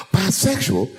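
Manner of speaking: mock horrified gasp.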